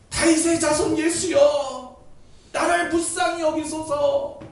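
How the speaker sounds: drawn-out, loud, high cry for mercy; earnest plea. Acted. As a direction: call out slowly and pleadingly. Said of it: "그렇게 간절하게". (간절하게 길게 부르짖기)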